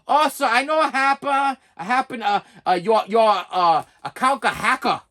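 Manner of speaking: mock foreign call-agent accent; halting imitation of foreign-accented English; imitated foreign accent. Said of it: "imitation of his accent".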